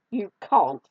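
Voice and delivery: loud, scoffing kitten; a sharp storybook sneer; scornful. A narrator's reading. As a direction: dismiss it with scorn. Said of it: "said the kitten scornfully".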